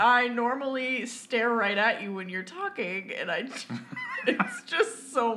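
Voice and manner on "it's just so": slow words through laughter; laughing. Real, not acted. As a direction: laugh while speaking. Laughing at face mask.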